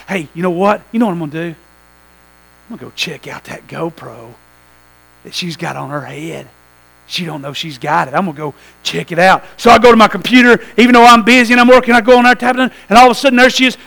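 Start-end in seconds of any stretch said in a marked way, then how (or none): 0.1-9.4 low, hushed scheming to himself; quietly plotting to peek at the camera; sneaky inner thought. Pretend. Husband hushed, plotting to check wife's GoPro, low and sneaky.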